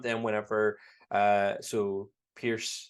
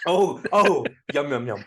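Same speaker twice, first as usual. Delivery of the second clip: hammed-up ogling robot butler, loud oohs; Pierce Brosnan impression; 4 semitones higher, 8 dB louder than usual. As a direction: imitate a smitten house voice relishing the view. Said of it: "Pierce Brosnan said".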